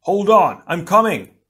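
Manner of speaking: high, loud rescue shout; shouted reassurance across a battle; urgent cry. A narrator's reading. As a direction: yell that help is on the way. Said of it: "Tahu cried".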